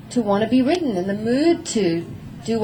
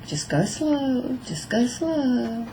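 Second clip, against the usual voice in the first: slow, soft, calming voice; slow calm ask; 3 semitones higher, 6 dB quieter, much slower than usual. Shown as a demonstration. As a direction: ease him down gently. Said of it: "I'm going".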